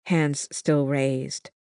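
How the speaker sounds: ordinary delivery, nothing marked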